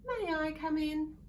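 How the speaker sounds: bright, high polite little bird voice; polite magpie. A narrator's reading. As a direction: ask sweetly like a polite magpie. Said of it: "Magpie asked politely".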